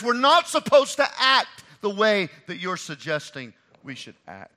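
preaching at full force. Retold or imitated. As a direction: thunder it from the pulpit. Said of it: "She's saying".

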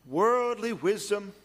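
normal talking voice

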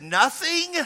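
high, rising mock disbelief; retold astonished query, voice leaping up; incredulous question. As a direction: question it as if you can't believe it. Retold incredulous reply to his claim.

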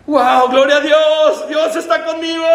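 high, loud exultant cry of praise; shouting glory to God in triumph; jubilant shout. Real, not acted. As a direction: burst out rejoicing, slowly. Rejoicing at the verse.

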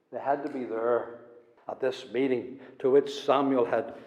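hushed, intimate sermon voice; soft earnest preaching. Acted. Preacher pleads softly with the congregation. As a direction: draw them in quietly.

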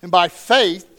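loud, measured Bible reading; scripture reading. Acted. Pastor reading Hebrews eleven to the congregation, loud and proclaiming. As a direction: proclaim each verse to the room.

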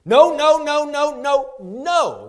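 loud, high repeated no's; emphatic no. Shown as a demonstration. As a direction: hammer each no like a shout of refusal. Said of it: "there's no stronger way in the Greek to say no".

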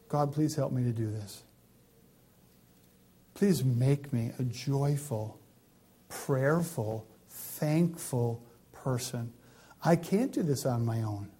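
soft, low, humble plea; quiet prayer. Real, not acted. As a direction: pray it softly to God. Preacher leads a prayer.

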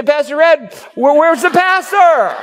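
high, half-laughing puzzled question; confused churchgoer. Acted. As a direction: ask the pastor where the pastor went, amused. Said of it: "You might come up to Pastor Ed and say".

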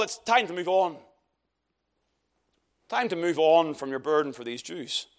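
ordinary talk, nothing marked

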